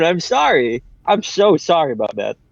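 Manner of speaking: ordinary talk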